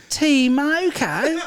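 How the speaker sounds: slow, high-pitched mock "T Moko"; "T Moko" exaggerated as TEE-MO-KO, making fun of it; mimicked Australian mispronunciation. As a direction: drag "T Moko" out syllable by syllable. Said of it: "the pronunciation thing, how Aus-, the Australians".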